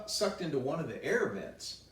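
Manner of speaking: usual speaking voice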